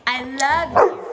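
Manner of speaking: loud, drawn pet-talk prompt; loud coaxing to a pet; dog trick cue. Real, not acted. Prompting dog to repeat words.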